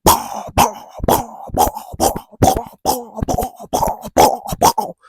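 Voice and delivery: breathy imitated punch noises; whispered mock punch noises; punch sounds. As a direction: puff out each punch like a sound effect.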